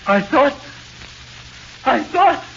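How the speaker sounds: very high, loud, broken panic; panicked stammer. Acted. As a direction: stumble over the words in terror. Prisoner panics at ghost's presence.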